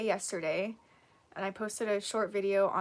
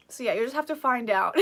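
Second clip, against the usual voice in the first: laughing; 5 dB louder than usual. Real, not acted. Teasing chat about practice stream.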